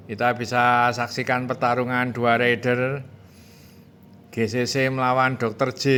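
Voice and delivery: loud, driving race call; live play-by-play. Real, not acted.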